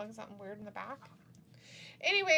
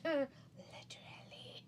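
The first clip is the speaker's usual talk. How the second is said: whispering; 4 semitones higher, 8 dB quieter than usual. Real, not acted. Sarcastic whispered joke.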